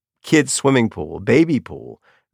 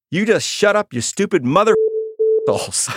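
put-on child telling boys off; 9 semitones higher than usual. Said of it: "And Dixie goes".

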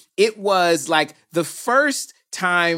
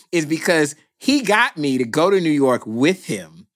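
usual speaking voice

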